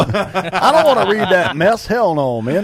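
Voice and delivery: high, loud, slow Southern drawl. Pretend. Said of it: "do your redneck accent".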